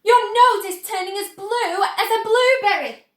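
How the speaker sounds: high, loud panicked cry; scream. A narrator's reading. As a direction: keep shrieking at the daughter in alarm. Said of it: "screamed Missus Beauregard".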